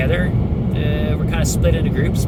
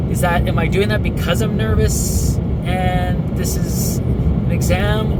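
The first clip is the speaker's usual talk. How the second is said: ordinary delivery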